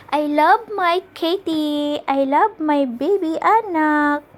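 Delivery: slow, loud, lilting coo; cooing to her pet. Real, not acted. Owner doting on her cat, slow high cooing.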